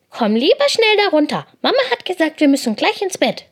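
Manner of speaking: high, loud little-brother voice; urging hare brother. A narrator's reading. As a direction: urge a sister to come down at once. Brother urges sister to come down.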